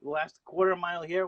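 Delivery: excited high race call; race announcer. Pretend. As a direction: call the last lap like a commentator. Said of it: "how about you call the final half a lap of a race?".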